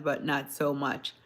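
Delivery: ordinary delivery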